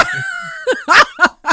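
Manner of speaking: high, loud laughing burst; loud burst of laughter. Real, not acted. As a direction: crack up out loud. Laughing at script joke.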